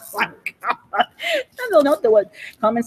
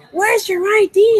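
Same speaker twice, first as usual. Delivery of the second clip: mock demand; 9 semitones higher than usual. Mocking a demand for ID.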